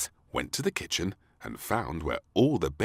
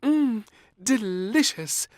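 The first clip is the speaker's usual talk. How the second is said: high, loud, slow savouring; savouring maid; 8 dB louder, slower than usual. A narrator's reading. Maid tucks into sandwiches, savouring them. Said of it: "said the maid".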